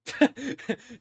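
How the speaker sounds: a quick high chuckle at the answer; short amused laugh. Acted. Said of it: "he laughs a little bit at that".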